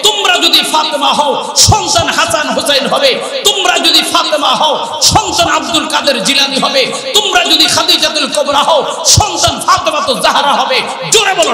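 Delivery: rhythmic shouted sermon refrains; fiery preaching. Acted. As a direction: preach at full force to the crowd.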